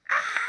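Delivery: sudden piercing mock scream; imitated loud scream. Roommate screaming as the ride drops, imitated shriek. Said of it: "the loudest scream you could possibly scream".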